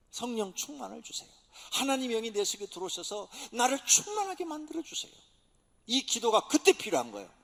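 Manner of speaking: ordinary talk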